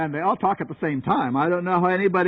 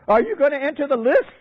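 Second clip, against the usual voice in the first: incredulous challenge; 7 semitones higher, faster than usual. Retold or imitated. Acted incredulous challenge to Luther.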